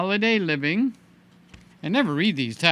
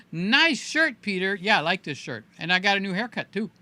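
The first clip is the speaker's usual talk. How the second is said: usual speaking voice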